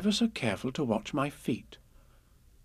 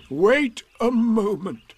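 loud, slow interruption; Eeyore; 9 semitones higher, 6 dB louder, much slower than usual. A narrator's reading. Eeyore stops Piglet mid-wish, loud drawn-out donkey voice. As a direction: halt them ponderously.

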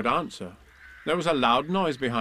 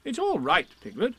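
Rabbit voice; 10 semitones higher than usual. Acted. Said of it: "Rabbit comforting".

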